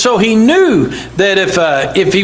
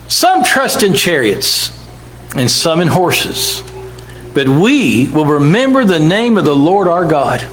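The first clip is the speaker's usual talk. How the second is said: measured preacher's reading voice; scripture reading; 4 semitones lower than usual. Acted. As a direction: read it out like holy writ. Preacher reads scripture aloud.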